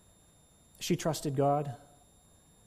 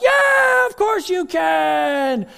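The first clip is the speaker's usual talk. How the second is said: very high shouted yeah, then slow; cheering crowd; 11 dB louder, much slower than usual. Acted. Crowd roars its faith in the daredevil, high shout.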